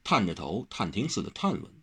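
ordinary delivery, nothing marked

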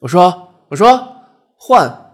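very high, loud yell; piercingly high, urgent shout; shouting upward. A narrator's reading. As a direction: call out insistently to the heavens. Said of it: "阿豪抬头喊".

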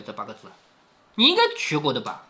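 ordinary talk, nothing marked

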